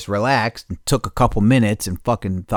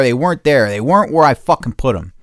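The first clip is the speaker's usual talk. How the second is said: ordinary talk, nothing marked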